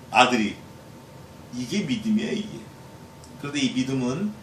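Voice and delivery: ordinary talk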